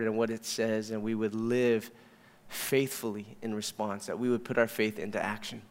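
soft, low congregational prayer. Real, not acted.

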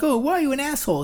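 high, fast, whiny imitation of other people; mock annoyed question. Imitating people who ask why he is rude, whiny. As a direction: toss it off as someone's nagging question. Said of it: "People are like".

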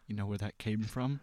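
low, hushed salesman-like impression; George Washington Carver. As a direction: confide it very softly and low. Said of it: "Give me your best George Washington Carver".

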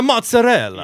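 mock over-the-top Italian MOZZARELLA; Italian accent. Host imitating a TV chef's sudden switch, exaggerated Italian accent. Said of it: "her perfect American accent".

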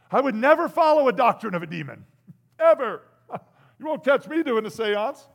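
emphatic denial. Real, not acted. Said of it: "emphatic denial".

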